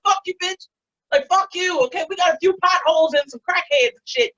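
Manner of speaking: high, hammed-up offended retort; defensive indignation. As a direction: snap back in mock outrage. Defending Baltimore against the room's reaction.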